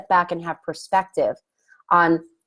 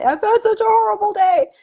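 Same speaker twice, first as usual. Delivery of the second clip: high, strained voice of a distraught stranger; upset young woman; 13 semitones higher, 4 dB louder than usual. Retold or imitated. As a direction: voice her shaken, much higher. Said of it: "then she went on to say".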